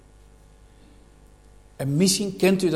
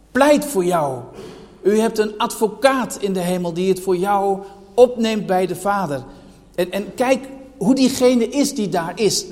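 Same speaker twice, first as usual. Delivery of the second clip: raised, ringing pulpit voice, higher and louder; preaching at full force; 4 semitones higher, 5 dB louder than usual. Acted. Preacher pressing the church with questions, full-force preaching. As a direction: hammer each rhetorical question home to the hall.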